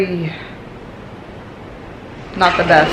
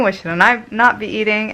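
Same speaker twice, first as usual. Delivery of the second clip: normal talking voice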